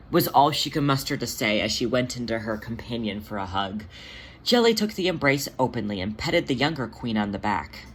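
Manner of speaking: plain reading voice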